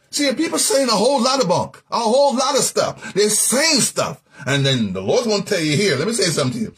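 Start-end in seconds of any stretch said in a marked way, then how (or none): none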